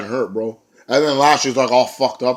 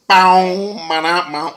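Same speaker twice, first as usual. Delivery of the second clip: loud, high sung sting; music sting imitation; 7 semitones higher, 6 dB louder, much slower than usual.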